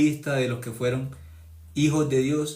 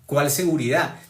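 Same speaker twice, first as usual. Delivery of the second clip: ordinary talk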